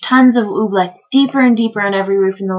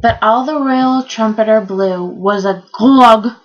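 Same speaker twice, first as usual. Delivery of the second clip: usual speaking voice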